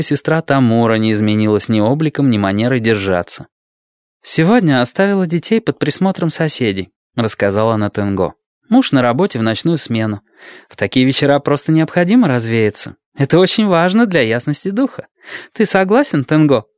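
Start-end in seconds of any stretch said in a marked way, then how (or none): 4.2-6.9 lively nurse voice. Acted. Nurse Tamura chatting with Tengo. 8.7-16.6 lively nurse voice. Acted. Nurse Tamura chatting with Tengo.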